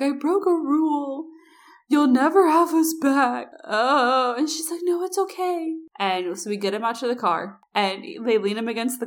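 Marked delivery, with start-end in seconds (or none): 0.0-3.5 put-on drunk remorseful guy. Sick drunk guy apologizing. 4.8-5.7 high, sing-song comforting voice; tipsy friend pats his head and comforts him; soothing drunk friend. Retold or imitated.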